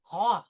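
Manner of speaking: roi with a throaty R; correcting roi. Shown as a demonstration. Teacher corrects Kritika with a throaty roi. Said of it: "The R comes from the back of the throat".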